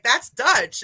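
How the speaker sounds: imitating Marlene's sure announcement; retold confident call. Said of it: "she was like".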